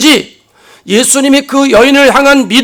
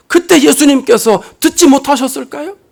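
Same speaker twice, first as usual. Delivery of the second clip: usual speaking voice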